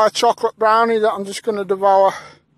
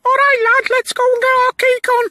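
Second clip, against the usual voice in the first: fast squeaky falsetto, much higher; silly high voice; faster than usual. Pretend. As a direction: chatter like a cartoon unicorn. Squeaky unicorn voices exchanged.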